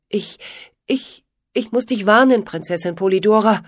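halting, stumbling start, repeating ich; stammering. A narrator's reading. Pitt stammers his warning to the princess, nervously. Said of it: "stammelte er".

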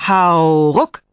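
lautes, gedehntes „Hau“, hohes „ruck“; Anfeuerungsruf. A narrator's reading. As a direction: im Takt des Ziehens rufen. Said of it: "riefen sie". (kräftiger Hauruck-Ruf)

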